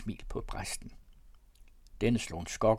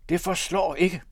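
loud, raised-pitch protest; higher and louder, cutting in; shouted objection; 7 semitones higher, 7 dB louder than usual. A narrator's reading. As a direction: call out your objection forcefully. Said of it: "råbte Fjaltring".

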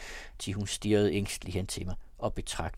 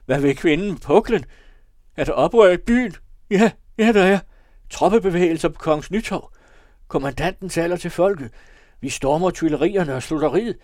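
high, loud mad raving; frenzied outcry, raised far above narration; delirious shouting; 7 semitones higher, 11 dB louder than usual. A narrator's reading. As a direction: rave wildly at imagined uproar. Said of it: "der havde vanvidets sorte sørgeflor".

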